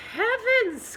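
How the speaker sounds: high, loud shocked "Heavens"; shocked cry. A narrator's reading. Farmer's wife, dismayed at the pig pen, a high shocked cry. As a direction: gasp it out in dismay. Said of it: "cries the farmer's wife".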